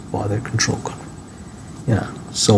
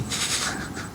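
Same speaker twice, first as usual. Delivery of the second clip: short breathy chuckle, no voice; soft, almost silent laugh; quiet laugh; 13 dB quieter than usual. Real, not acted. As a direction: let out a soft little laugh. Joking about not being bothered.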